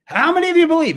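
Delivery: high showman's call to the crowd; rousing showman call. Retold or imitated. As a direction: work the crowd. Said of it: "He's a good showman".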